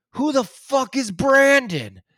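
high, loud, drawn-out retold outrage; mock angry shout. Neighbour dismisses the name-drop, mock angry shout. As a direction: imitate an exasperated neighbour yelling.

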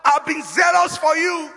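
high-pitched protest to God; aggrieved guilt-tripping. Acted. Elijah making God feel bad about himself, aggrieved. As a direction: plead it high, laying on the guilt. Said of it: "trying to really make God feel bad".

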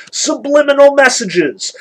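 sarcastic spooky exclamation; mock dramatic reveal. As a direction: announce it like a mock revelation. Mocking conspiracy readings.

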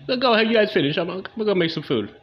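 high, loud, fake-casual permission; mock calm girlfriend. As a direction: pretend she doesn't care at all. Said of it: "Girl's like".

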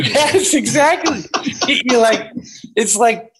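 giggly, high laughing agreement; laughing yes. Real, not acted. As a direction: burst out laughing as you agree.

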